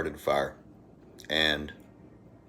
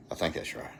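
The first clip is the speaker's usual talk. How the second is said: half-whispered to herself, reading off the phone; reading names aloud, she whispers to herself; 7 dB quieter than usual. Real, not acted. Squinting at a phone screen, a soft whisper.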